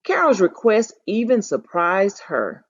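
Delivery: ordinary talk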